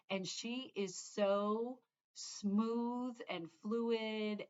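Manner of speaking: very slow, dreamy admiration; admiring. Real, not acted. Praising a stitcher's calm presenting, slow dreamy awe. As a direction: drag out smooth and fluid like savouring it.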